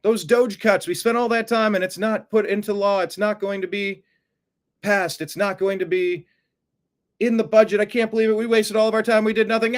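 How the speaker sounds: hammed-up despair; mock freakout. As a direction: act out a panicking supporter. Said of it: "So yeah, we freak out".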